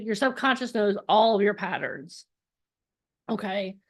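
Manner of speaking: usual speaking voice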